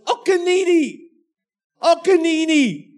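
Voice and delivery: put-on high, loud little-girl yell; toddler yelling. Dad imitating his two-year-old yelling for her goggles. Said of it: "Trinity's starting to yell this".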